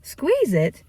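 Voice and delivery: incredulous echo. Retold or imitated. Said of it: "And I was like".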